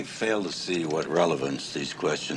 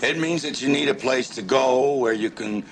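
raised voice, pitched up; speaking up; 5 semitones higher than usual. Real, not acted. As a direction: repeat it so they can hear. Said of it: "Could you speak up".

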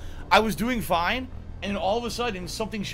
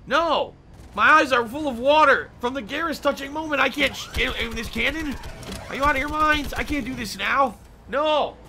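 loud, high-pitched outrage; indignant protest; 8 semitones higher, 3 dB louder than usual. Real, not acted. Player outraged that the game spoiled a touching moment, indignant protest. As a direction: protest in high, exasperated disbelief.

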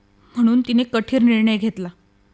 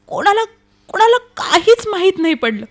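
distressed; 5 semitones higher, 5 dB louder than usual. Acted. Distressed account of the sister vanishing.

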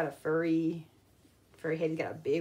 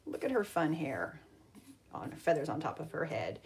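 whisper; 3 semitones lower, 5 dB quieter than usual. Real, not acted. Teacher pointing at the picture, whispering. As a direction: whisper it like a secret.

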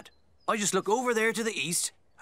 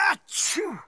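sneeze; 8 semitones higher than usual. Acted. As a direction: let out a big achoo. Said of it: "Was that a sneeze I heard?".

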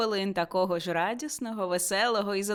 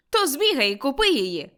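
eager instruction; 5 semitones higher, 5 dB louder than usual. A narrator's reading. Scrooge eagerly gives an order.

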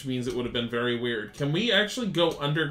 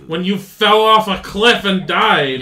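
mocking; 7 semitones higher, 13 dB louder, slower than usual. Mocking partner in game.

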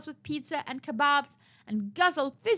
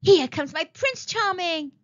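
slow, raised, dreamy delight; smiling; 6 semitones higher, 6 dB louder than usual. A narrator's reading. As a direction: savour the arrival of her prince. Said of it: "smiled Princess Reverie".

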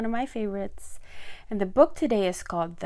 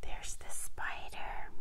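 soft, hushed whisper; whispered aside; 7 dB quieter than usual. Real, not acted. Reader pointing out the sleeping spider to children, whispering. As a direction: whisper so the sleeper is not woken.

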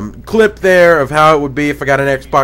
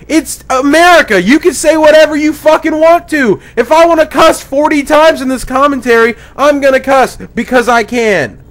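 heated rant; 9 semitones higher, 4 dB louder than usual. Real, not acted. Ranting at Microsoft over cussing ban.

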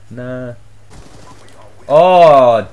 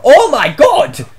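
startled outburst; 9 semitones higher, 6 dB louder, slower than usual. Real, not acted. Gamer startled mid-match.

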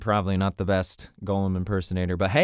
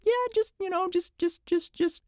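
very high pinched squeak; helium voice; 3 dB quieter than usual. Shown as a demonstration. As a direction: squeeze it up tight.

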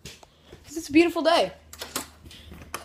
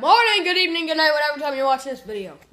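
loud excited greeting; 7 semitones higher, 14 dB louder, faster than usual. Acted.

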